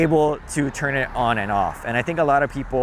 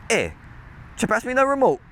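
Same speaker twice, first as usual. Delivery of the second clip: Pidgin request; 10 semitones higher than usual. Shown as a demonstration. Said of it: "So for example".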